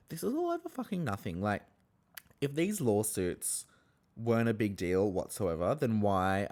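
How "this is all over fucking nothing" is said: high, fast, soft exasperation; exasperated. Retold or imitated. Host watching the fight, exasperated and quick. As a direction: rattle it off in disbelief. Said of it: "and I'm like".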